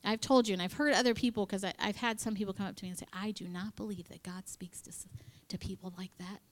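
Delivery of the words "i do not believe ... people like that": hushed, whispered imitation of a skeptic; hushed disbelief. People coming up to say they don't believe, whispered. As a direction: whisper it like a doubter confiding. Mimicking doubters confiding disbelief.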